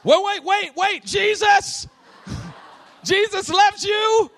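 very high, loud, stretched mock yell; frantic calling out. Preacher calling after the dispersed crowd, comically frantic high shout. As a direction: holler after people already leaving, dragging out the words.

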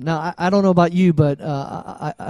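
ordinary talk, nothing marked

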